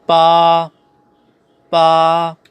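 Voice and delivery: PA long and level; "pa" held in first tone, no glide; Mandarin pronunciation. Shown as a demonstration. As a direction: sustain "pa" on a steady pitch. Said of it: "Number eight".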